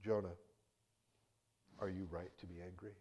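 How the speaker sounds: soft, calm asking; gentle question. Acted. God gently asking Jonah about his anger, softly. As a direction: ask it tenderly, without anger. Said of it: "he offers this gentle question".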